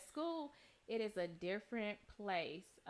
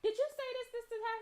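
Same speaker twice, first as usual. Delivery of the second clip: mock scolding mom; 11 semitones higher, faster than usual. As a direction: play the other mom grilling her son. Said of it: "She's like".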